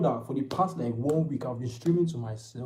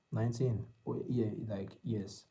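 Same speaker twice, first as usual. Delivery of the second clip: ordinary delivery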